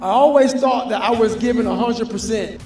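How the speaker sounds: ordinary talk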